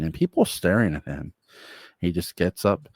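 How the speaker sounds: usual speaking voice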